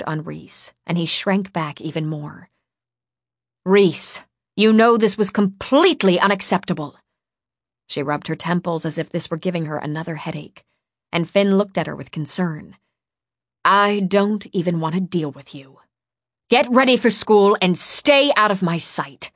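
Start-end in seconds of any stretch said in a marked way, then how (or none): 3.7-7.0 loud, stern, emphatic rebuke; angry reprimand. A narrator's reading. Furious woman reprimands the boy, loud and stern. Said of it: "She had stopped yelling". 13.6-19.3 exasperated, building to a loud order; angry dismissal. A narrator's reading. Fed-up woman orders the boy away, angry dismissal. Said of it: "She had stopped yelling".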